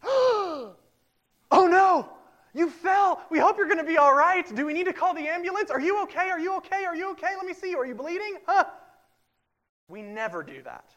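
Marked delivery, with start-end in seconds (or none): 0.0-8.6 put-on high, loud, frantic fussing over a fallen child; hammed-up alarmed mom gushing over a tumble; panicked parent. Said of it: "here's what we don't do".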